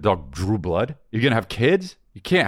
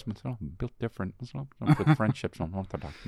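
hushed gossip; 8 dB quieter, slower than usual. Retold or imitated. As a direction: murmur it like a secret. Imitates gossiper, slow and hushed.

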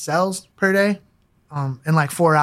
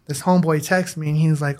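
ordinary talk

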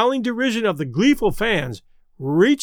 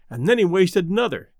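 ordinary delivery

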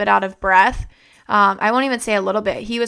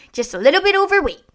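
imitating dad; 9 semitones higher, faster than usual. Dad's quoted catchphrase.

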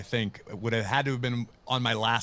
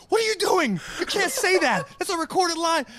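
very high, loud alarmed supervisor; mock high-pitched supervisor scolding; panicked supervisor; 5 dB louder than usual. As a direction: squeak out the boss's panic. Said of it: "my supervisor goes".

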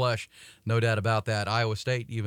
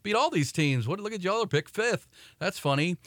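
mock taunting; 6 semitones higher than usual.

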